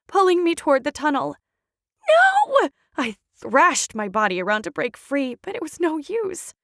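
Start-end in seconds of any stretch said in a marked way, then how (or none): 2.1-2.7 very high desperate scream. Acted. Charlotte dragged from Young, very high scream. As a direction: scream as you're dragged away. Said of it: "I screamed".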